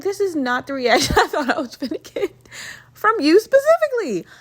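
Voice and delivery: high, loud, laughing disbelief; incredulous laugh-through, pitch soaring at the end; shocked disbelief. Retold or imitated. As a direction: replay the shock, climbing to a squeal. Retelling her shocked reaction, laughing.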